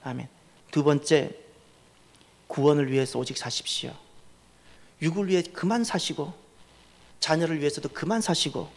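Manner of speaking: ordinary delivery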